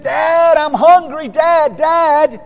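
put-on high, loud kid calling for dad; insistent child. Hungry son calls his dad from the back seat, insistent. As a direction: pester loudly like a hungry child.

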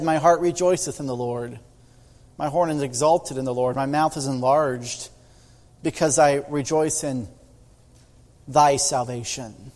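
low, measured reading of prayer; scripture reading. Acted. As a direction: read the verse softly and solemnly. Reads Hannah's prayer.

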